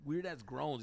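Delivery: usual speaking voice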